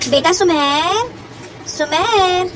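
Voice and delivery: drawn-out, slow call of a name. Acted. Mother calling her son to pray, calling out. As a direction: call your child from another room.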